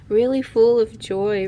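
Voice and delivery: ordinary talk, nothing marked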